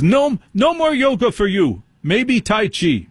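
high-pitched German doctor's verdict; mock German accent. Surgeon banning yoga for his patient, high mock German. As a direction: lay down the rule in a comic German accent. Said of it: "pretend to be German".

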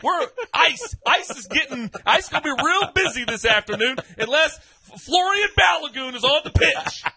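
mock threat. Mock deportation threat over player ban.

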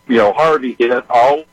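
ordinary delivery

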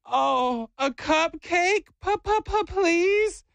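put-on very high, slow, stuttered kid plea; little boy pleading. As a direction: imitate a child's rehearsed cutesy begging. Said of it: "And he goes".

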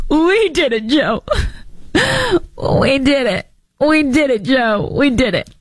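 high, laughing mock Kamala cheer; Kamala impression. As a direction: repeat it gleefully like Kamala's viral call. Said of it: "then you have Kamala Harris".

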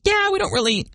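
high-pitched mock gals voice; travelers' wives. Host mimics wives who travel without husbands, high mock voice. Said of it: "these gals are like".

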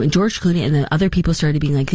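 usual speaking voice

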